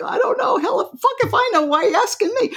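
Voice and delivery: the mind snapping back at you; exasperated mind. Acted. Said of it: "The mind's like".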